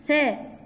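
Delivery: ثاء said with the tongue between the teeth. Shown as a demonstration. Teacher showing kids the tongue out for ثاء. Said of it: "بطلع لساني وأنا بقول الثاء".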